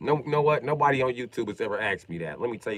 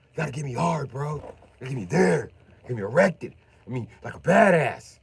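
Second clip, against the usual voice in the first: imitating Will Ferrell; 4 semitones higher, 7 dB louder than usual. Retelling the Will Ferrell prison movie, a mild naive voice building louder. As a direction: quote the movie line, getting louder to the end. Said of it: "He was like".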